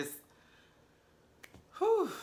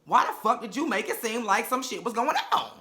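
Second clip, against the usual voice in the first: normal talking voice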